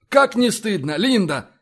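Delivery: indignant. A narrator's reading. Fox rebuking Linda for her prank, indignant. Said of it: "возмутился Фокс".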